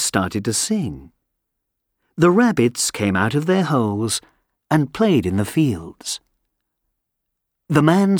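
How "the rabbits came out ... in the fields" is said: ordinary delivery, nothing marked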